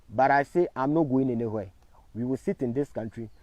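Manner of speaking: ordinary talk, nothing marked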